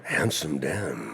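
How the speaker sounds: low, drawn-out announcer; slow, deep trailer voice-over; movie trailer voice. Shown as a demonstration. Guest repeats the host's nickname, movie trailer voice. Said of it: "And, and I'll do the thing".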